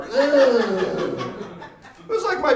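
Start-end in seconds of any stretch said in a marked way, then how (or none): 0.0-1.3 loud mock disgusted grunt; disgusted ugh. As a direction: echo the repulsed ugh. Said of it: "sound effect of insecurity".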